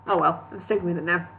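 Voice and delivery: ordinary delivery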